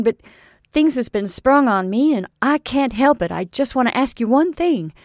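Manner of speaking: loud, rising, pressing sea captain; earnest outburst. A narrator's reading. Captain pressing Missus Trimmer with his feelings, loud and urgent. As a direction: pour it all out at her.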